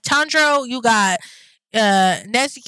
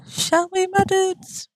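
higher, teasing sing-song; playful high repeat; 8 semitones higher, slower than usual. Acted. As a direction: toss it up high and playful to the viewers.

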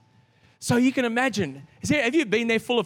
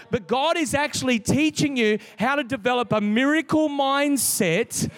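preaching; 5 semitones higher than usual. Acted. Pastor exhorting the congregation, rising preaching voice. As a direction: drive the point home to the crowd.

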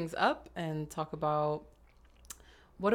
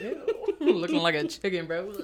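laughing; 3 semitones higher than usual. Real, not acted. Teasing co-host laughing.

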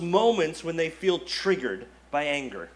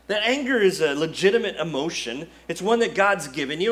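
ordinary talk, nothing marked

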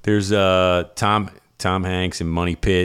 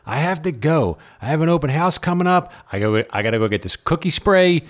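higher, hurried mock agent; impatient agent rushing out, higher; imitating the realtor hurrying off, higher; imitating Jason Bateman; 8 semitones higher than usual. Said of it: "and he's like".

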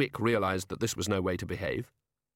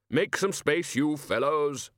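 mild bellow; 5 semitones higher, slower than usual. A narrator's reading. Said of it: "the Arch-Chancellor bellowed".

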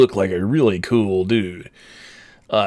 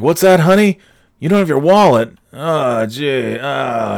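put-on doofus customer; 4 semitones higher than usual. Customer feigning a forgotten wallet to his wife, hammed-up. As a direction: play up a phony forgotten-wallet act. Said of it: "just a fucking act that this guy does".

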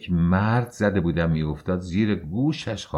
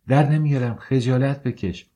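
defiant refusal; 6 semitones higher, 3 dB louder than usual. Acted. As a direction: throw the refusal back. (امتناع بلند و سرکش)

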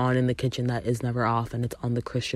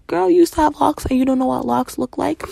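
high, loud mocking disbelief; mock exasperated sass; incredulous retort; 11 semitones higher, 6 dB louder, faster than usual. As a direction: roll your eyes through it. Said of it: "I was like".

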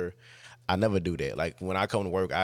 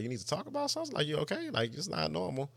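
much higher voice, imitating worried supervisor; retold concern, high and slightly softer; concerned questioning; 10 semitones higher, 4 dB quieter than usual. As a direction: press gently in a raised pitch. Supervisor asks upset worker to talk.

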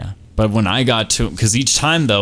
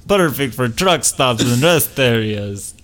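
put-on vampire with a Russian accent; slow, heavy Russian vampire; 3 semitones higher, slower than usual. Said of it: "Why did the vampire ... my Russian accent?".

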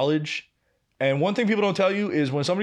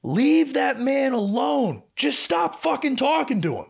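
mock yell, stretching the first words; imitated yelling; 9 semitones higher than usual. Coworker breaks up a kitchen fight, imitated yelling. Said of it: "Marvin just starts yelling".

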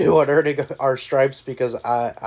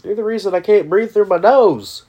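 angry yelling; 8 semitones higher, 10 dB louder than usual. Retold or imitated. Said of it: "He's like".

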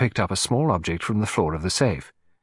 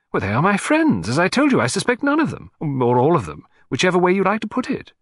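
high, lively protest; agitated gentleman in a higher voice; flustered collector; 9 semitones higher, 4 dB louder than usual. Acted. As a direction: protest to the detective, pitch leaping up. Narrator voices the client.

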